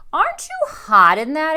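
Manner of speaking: put-on high, loud boy's voice; big brother teasing, loud and high; Luke's voice. A narrator's reading. Brother teases Rascal about costume, high and loud. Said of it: "asks Luke".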